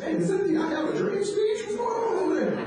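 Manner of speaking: high, excited mock call across the room; incredulous call-out. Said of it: "I said".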